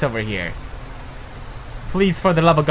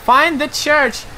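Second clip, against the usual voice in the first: higher, slow, sing-song reading of the task; higher, slow mock order; mock instruction; 8 semitones higher, slower than usual. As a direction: parrot the game's order mockingly. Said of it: "but I'm like".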